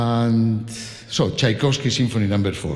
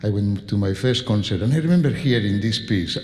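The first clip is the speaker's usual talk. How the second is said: usual speaking voice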